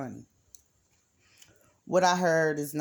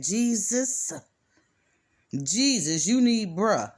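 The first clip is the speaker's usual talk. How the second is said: louder, preaching it out; loud proclamation; 4 semitones higher, 6 dB louder, slower than usual. Retold or imitated. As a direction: call out the name like a revelation. Proclaims the answer loudly.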